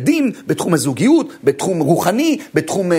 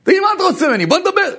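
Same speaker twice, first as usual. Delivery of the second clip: eager; 4 dB louder, faster than usual. Retold or imitated. Friend suddenly eager to talk.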